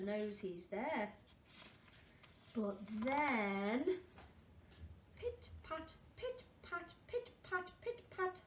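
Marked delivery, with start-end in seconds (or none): none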